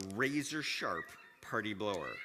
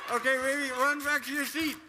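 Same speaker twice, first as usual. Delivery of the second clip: loud calling; 12 semitones higher, 7 dB louder than usual. Real, not acted. Shouting directions after her.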